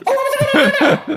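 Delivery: a very high squeaky voice through laughter; high-pitched impression of the mother. Friend asks for the mother's accent, very high mock squeak. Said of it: "why don't you do her accent?".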